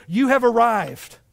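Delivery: loud, slow navigation announcement; imitating Siri. Navigation voice directing the preacher, loudly proclaimed arrival. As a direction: announce it like a satnav, loud and drawn out. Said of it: "and then it says".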